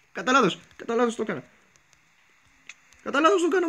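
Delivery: real fright, pitched high; panicked begging. Real, not acted. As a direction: plead for your life in a squeaky rush. Begging mob not to attack.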